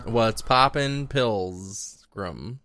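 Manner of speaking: slow cowboy impression; drawn-out film-cowboy greeting; John Wayne. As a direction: draw it out like a movie cowboy, falling away. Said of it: "He always used to talk like this".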